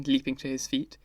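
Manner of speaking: usual speaking voice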